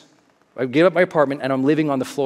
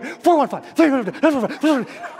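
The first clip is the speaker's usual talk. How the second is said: higher, louder chant of digits; shouting numbers; 10 semitones higher, 3 dB louder than usual. Retold or imitated. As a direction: yell the numbers to remember them. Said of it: "screaming at the top of my lungs".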